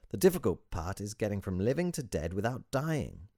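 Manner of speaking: put-on high, animated, accented guru salesman; salesman's climactic pitch. A narrator's reading. Sage pitching his method to visitor, zealous salesman. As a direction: sell the doctrine with rising zeal. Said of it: "a salesman at the climax of his pitch".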